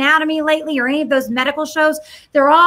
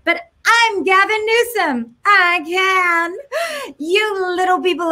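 higher, slow, smug politician mockery; mock Gavin Newsom; 5 semitones higher, much slower than usual. Mocking the governor to viewers, higher smug sneer. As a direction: sneer it like a self-satisfied governor who can do anything. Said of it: "I mock and I do the voices".

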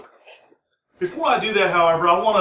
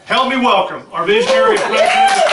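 high, loud announcer call to the crowd; rising emcee shout for applause; big stage welcome; 8 dB louder than usual. Real, not acted. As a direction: pump up the room as you bring him on. Introducing the president to the crowd.

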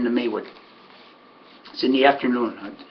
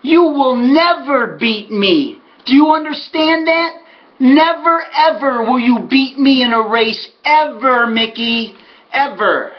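raised, emphatic retold taunt; pumped-up fury; 8 semitones higher, 3 dB louder, slower than usual. Winner tells off his rival after the race, furious retold outburst. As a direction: hammer each word at a beaten rival. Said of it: "so pumped up and so, uh, furious".

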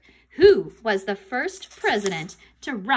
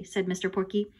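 ordinary delivery, nothing marked

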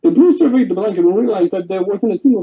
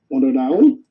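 ordinary delivery

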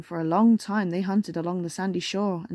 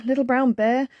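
ordinary delivery, nothing marked

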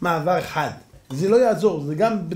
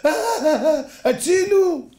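mock cry for help; 11 semitones higher than usual. Cripple cries for help to the crowd, hammed-up wailing. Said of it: "פתאום זה צועק".

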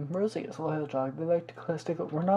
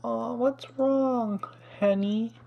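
cooing to pet; 6 semitones higher, much slower than usual. Real, not acted. Cooing to upset Sims pet.

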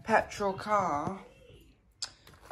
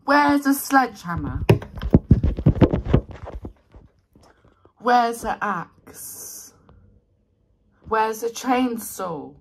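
demanding call; 3 semitones higher, 8 dB louder than usual. Pretend. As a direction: holler for a bigger tool. Calling out for bigger tools.

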